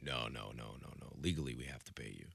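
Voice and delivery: very low, hushed imitation of a boss; dismissive boss. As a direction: play the boss softly talking him down. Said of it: "And he's like".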